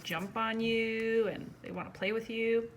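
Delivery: plain reading voice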